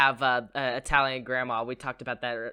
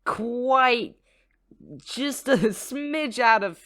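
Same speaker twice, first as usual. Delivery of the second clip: high, slow, drawn-out sarcastic voice; mock understatement; 8 semitones higher, 6 dB louder, much slower than usual. Cohost teasing the movie's historical slip, drawn-out sarcasm. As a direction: stretch each word like a wry understatement. Mocking the film's history error.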